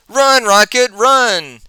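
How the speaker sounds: high urgent call to run; urgent warning cry. Acted. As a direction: cry out to warn him. Lila warns Rocket in danger.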